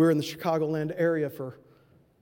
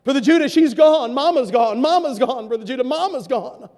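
grieving caller; 5 semitones higher, 8 dB louder than usual. Retold or imitated. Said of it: "a deep voice saying".

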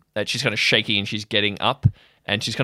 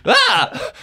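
high shriek as he falls; a short shrill dying scream; death cry; 6 dB louder than usual. Acted. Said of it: "he shrieks and falls over".

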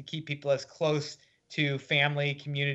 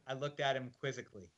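usual speaking voice

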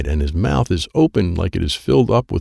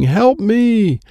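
loud, high drawn-out scream; 5 dB louder than usual. A narrator's reading. Girl buried under cards begging rescue, screaming. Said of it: "She is screaming".

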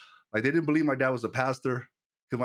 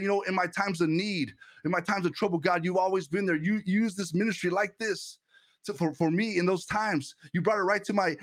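higher, driving sermon voice building to a climax; fervent preaching; 4 semitones higher than usual. Acted. Preacher rallying his Twitch chat to trust God, fervent preaching. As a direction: preach it, pushing the congregation to trust.

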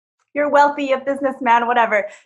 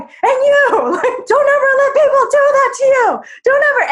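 higher, louder scolding shout; stern yelling; 5 dB louder than usual. Retold or imitated. Brother scolding his sister, high loud stern shout. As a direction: bark the warning at his sister.

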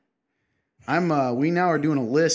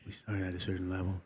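much softer, lower imitation; mock hush; 6 semitones lower, 14 dB quieter than usual. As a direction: mimic his forced quiet voice. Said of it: "trying so hard to be quiet".

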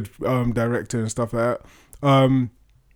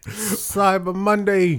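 drawn-out; 6 semitones higher, much slower than usual. Acted. Echoes the deal talk.

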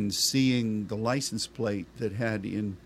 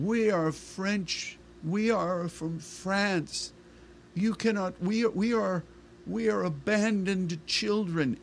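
tearful plea; 9 semitones higher than usual. Retold or imitated. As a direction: plead through tears, voice lifted high. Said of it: "She started weeping".

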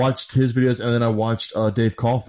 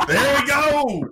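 triumphant cheer; much slower than usual. Real, not acted. Host triumphant after forced correction.

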